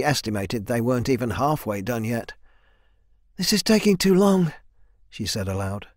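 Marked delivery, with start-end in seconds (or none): 3.4-4.5 higher-pitched character voice; urgent complaint. A narrator's reading. Swordswoman complaining aloud to the men, urgent complaint. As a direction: voice her impatience, pitched up.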